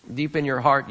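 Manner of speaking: ordinary talk, nothing marked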